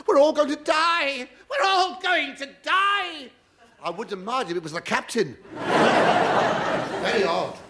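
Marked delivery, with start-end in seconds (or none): 0.1-3.3 high, hammed-up panicked wail; mock panicked scream. Comic imitating the screaming passenger on deck, hammed-up panic. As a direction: wail like a man pulling his hair out. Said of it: "He was screaming".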